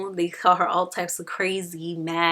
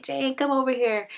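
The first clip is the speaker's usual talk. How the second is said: higher-pitched retold call; mock calling over; 7 semitones higher than usual. Rochester spots Jane in the garden, mock calling over. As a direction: call someone over, lightly acted.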